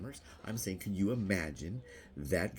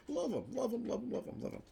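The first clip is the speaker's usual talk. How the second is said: squealing fan. Acted. Mock adoring fan.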